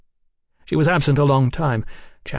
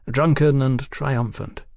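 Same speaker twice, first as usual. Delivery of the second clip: ordinary delivery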